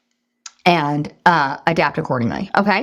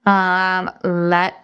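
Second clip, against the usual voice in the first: ordinary talk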